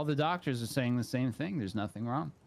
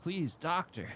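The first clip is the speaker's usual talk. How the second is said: acted pleading; 7 semitones higher, slower than usual. Patient begs doctor for help.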